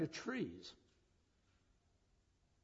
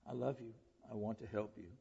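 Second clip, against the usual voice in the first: gentle; 4 semitones lower, 7 dB quieter than usual. Shown as a demonstration. Modelled loving words to world.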